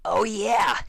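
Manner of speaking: loud, high, proud exclaim; excited shout. Acted. Dash owning up proudly, loud excited shout. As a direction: blurt it out with glee.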